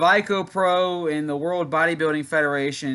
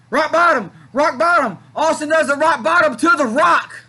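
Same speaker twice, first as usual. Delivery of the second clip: excited shout; 12 semitones higher, 9 dB louder than usual. Real, not acted. Calling finishing move live.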